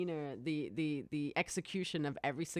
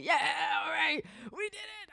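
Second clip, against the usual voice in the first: high, squealed imitation of a victory cheer; mock cheering; 13 semitones higher, 3 dB louder than usual. Friends sharing a controller finally beat it, mock cheering. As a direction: celebrate in a strained, high pretend yell. Reenacts friends winning a game together.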